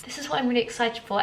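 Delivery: ordinary talk, nothing marked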